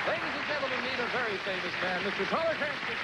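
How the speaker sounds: grand, high guest introduction; game show host. Acted. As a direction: announce the star with flourish.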